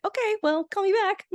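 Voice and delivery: high, chirpy character imitation; Phoebe. Podcast host mimics Phoebe's mannerisms, high-pitched impression. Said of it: "Phoebe's mannerisms are very much like our Phoebe".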